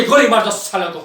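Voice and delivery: building to a loud, high command; furious order. Acted. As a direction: drive each order harder in rage. Officer recounts and urges killing them all.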